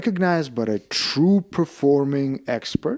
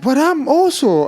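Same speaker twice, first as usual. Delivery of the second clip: high, loud mock protest; inner protest; 8 dB louder than usual. Actor objects in his own mind to a stuntman, mock protest.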